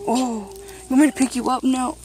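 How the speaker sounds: ordinary delivery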